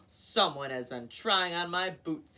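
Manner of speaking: loud booming bear; big booming bear voice. A narrator's reading. Papa Bear booming about his boots. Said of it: "he boomed in his big bear voice".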